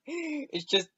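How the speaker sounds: giggling as he scoffs; laughing mockery. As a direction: laugh through the words at him. Gordon mocks through laughter.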